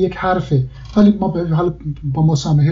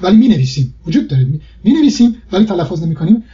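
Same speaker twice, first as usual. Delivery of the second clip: usual speaking voice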